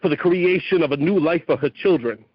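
rising fervent poem to a mother goddess; spoken-word prayer. Acted. Poet invoking the storm mother, fervent spoken word. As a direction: preach it like an invocation.

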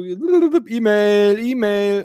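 playful jingle voice; mock notification voice. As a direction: imitate the phone chiming E-Mail. Imitating phone notification.

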